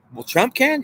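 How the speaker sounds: high, rising disbelief on can; incredulous question. Acted. Questioning his own answer, a high rising disbelief.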